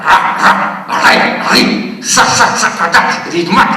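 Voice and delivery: loud barked syllables ra ra sa sa; rhythmic diction drill. Shown as a demonstration. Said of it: "și începe așa".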